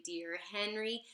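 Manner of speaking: ordinary delivery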